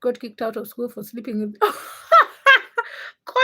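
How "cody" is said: high, loud burst of real laughter; helpless high-pitched laughing; cracking up. Real, not acted. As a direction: crack up around the name. Cracks up reading a chat message.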